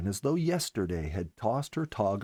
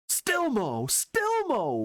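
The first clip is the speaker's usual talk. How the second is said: calling out; 14 semitones higher, much slower than usual. A narrator's reading. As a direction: call out his name with joy. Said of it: "we called in unison".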